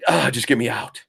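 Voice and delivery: usual speaking voice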